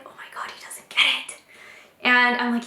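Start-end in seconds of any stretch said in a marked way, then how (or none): 0.0-1.4 breathless fast whisper to herself; whispered aside. Retold or imitated. Wife whispering her panic while husband misses the hint, hushed. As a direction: whisper it so he won't hear.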